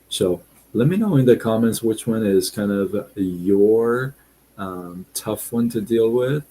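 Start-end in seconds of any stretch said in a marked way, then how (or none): none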